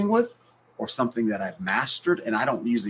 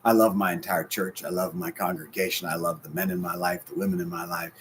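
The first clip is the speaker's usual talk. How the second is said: usual speaking voice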